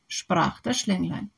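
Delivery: usual speaking voice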